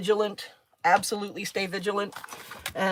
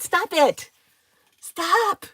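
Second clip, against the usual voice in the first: playful pet scolding; 11 semitones higher, 5 dB louder, much slower than usual. Real, not acted.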